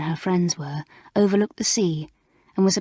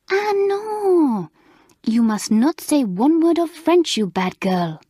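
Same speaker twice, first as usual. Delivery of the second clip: high, loud French scolding; scolding French mistress; 8 semitones higher, 4 dB louder, slower than usual. Acted. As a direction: tell off the naughty pupil in a French accent. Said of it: "scolded Mamselle".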